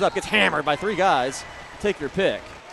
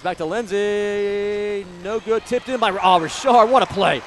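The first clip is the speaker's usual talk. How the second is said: raised voice building to a loud cry; excited play call; 5 semitones higher, 6 dB louder, slower than usual. Real, not acted. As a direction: stretch the pass in suspense, then burst out amazed. Calling a tip-in basket.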